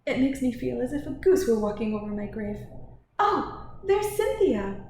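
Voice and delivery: fussy lady, lifting to a high, loud cry; animated society matron; exclaiming Mrs Inglethorpe. Acted. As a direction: shudder lightly, then call out on spotting someone. Voices Mrs Inglethorpe, spotting Cynthia.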